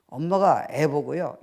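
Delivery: ordinary talk, nothing marked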